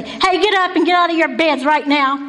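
urgent order through a door; brusque wake-up call. Shown as a demonstration. Speaker rousing the men from bed, brusque. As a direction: order them up, no patience. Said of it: "knock on the door and say".

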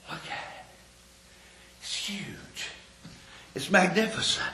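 breathless near-whisper of wonder; soft awestruck murmur; awed hush. Retold or imitated. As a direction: hush it as if gazing up at a mountain. Awed thought at the mountain.

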